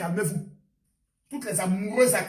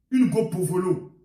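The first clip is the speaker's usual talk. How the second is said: ordinary talk, nothing marked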